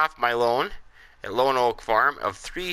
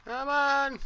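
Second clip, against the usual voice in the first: high pleading whine; 14 semitones higher, 5 dB quieter than usual. Real, not acted. Waiting on the game.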